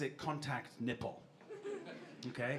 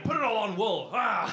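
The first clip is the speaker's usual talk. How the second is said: higher, louder playful gambling cry; mock croupier call; 4 semitones higher, 6 dB louder than usual. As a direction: call the bet out with gusto, ending in a laugh. Mimics croupier spinning the dial.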